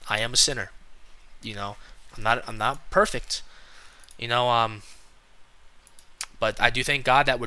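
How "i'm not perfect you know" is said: usual speaking voice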